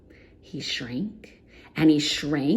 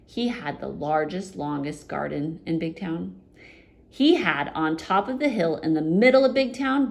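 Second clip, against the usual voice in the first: usual speaking voice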